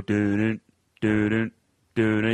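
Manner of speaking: low, humming the Jaws shark theme; low dun-dun of the Jaws theme; Jaws theme hum. Retold or imitated. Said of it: "I feel like I'm in Jaws".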